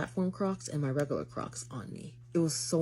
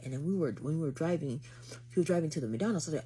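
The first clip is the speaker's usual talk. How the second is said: normal talking voice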